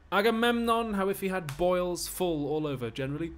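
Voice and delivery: high, loud stage taunt. Acted. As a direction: needle your master at full voice. Slave taunting master in play.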